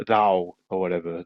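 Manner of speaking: ordinary talk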